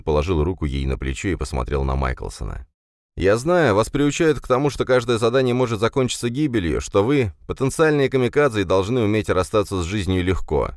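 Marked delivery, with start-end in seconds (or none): 3.2-10.7 raised earnest male voice; Ben, firm and urgent. A narrator's reading. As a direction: press the point firmly.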